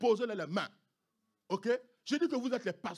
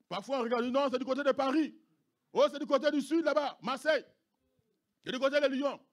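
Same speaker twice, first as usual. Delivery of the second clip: louder, raised preaching; fiery preaching; 7 semitones higher, 8 dB louder than usual. Acted. Pastor warning about danger in France, loud preaching. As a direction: press the point with force.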